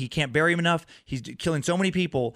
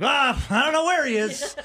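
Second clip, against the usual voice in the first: mock innocence; 10 semitones higher, 4 dB louder than usual. Killer assigned to catch himself.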